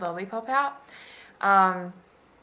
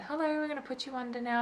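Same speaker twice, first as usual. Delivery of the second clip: high, soft, quick doctor voice; mock doctor patter; 6 semitones higher, 6 dB quieter, faster than usual. Doctors to patient before the anaesthetic, quick soft patter. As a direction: lightly imitate a doctor breezing through an announcement.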